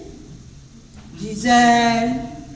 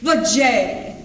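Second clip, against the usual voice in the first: usual speaking voice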